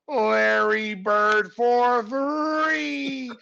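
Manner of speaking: loud, drawn-out announcer call; booming, slow basketball announcer impression; arena announcer. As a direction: stretch each word over the PA. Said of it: "the announcer go".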